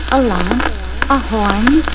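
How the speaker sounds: ordinary delivery, nothing marked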